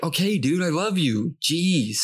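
fake-shout it at the roommate, annoyed; mock yell. Nate yelling at his roommate, mock-exasperated. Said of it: "he just starts yelling at him".

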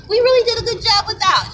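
high, fast gloating demon voice. Retold or imitated. Demons gloating over quitters, high gloating voice. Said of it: "demons are".

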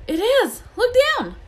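high, loud cry of amazement; excited yell. A narrator's reading. Ron sees Harry's body vanish, yelling in excitement. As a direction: yell it out, astonished. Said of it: "Ron gave a yell".

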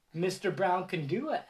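ordinary delivery, nothing marked

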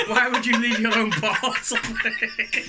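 high, loud and drawn-out disbelief; incredulous. Retold or imitated. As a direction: laugh through your comic bafflement. Baffled retelling of a mix-up.

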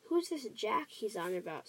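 ordinary delivery, nothing marked

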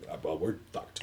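low, soft mock resignation; deflated imitation of giving up; resigned defeat. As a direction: drop down quietly, all hope gone. Acted hopeless reaction to ending.

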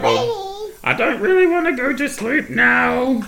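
put-on high, slow, sulky baby voice; baby's voice. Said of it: "she was just like".

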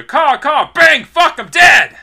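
loud, high screeching crow dying; shrill screeching bird woman. Pretend. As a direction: screech like a shot crow falling. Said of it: "I got an impression for you".

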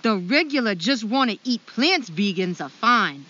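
loud attempt at a Maine accent. Host continues Tim's write-in, bad Maine accent. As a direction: read it out in a put-on Maine accent. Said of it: "kill this Maine accent".

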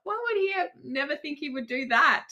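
high, soft words squeezed through giggles; real giggly squeak, barely voiced; helpless laughter. Real, not acted. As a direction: try to talk while cracking up. Both hosts cracking up laughing.

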